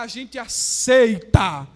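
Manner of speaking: loud, slow, drawn-out declaration; defiant vow. Acted. The youths to the king, loud and slow defiance. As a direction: proclaim it slowly at full force.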